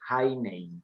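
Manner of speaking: Heine said HAI-ne, stress on the first syllable; German pronunciation. Shown as a demonstration. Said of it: "el acento en la primera sílaba".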